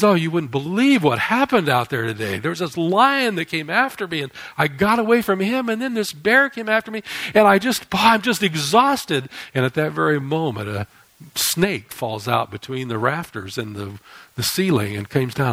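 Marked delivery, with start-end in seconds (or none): 0.0-9.3 high, excited gasping storyteller; mock breathless. Man telling his wife of the lion and bear: breathless and high. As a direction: pant it out like a man who just ran home. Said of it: "he's out of breath".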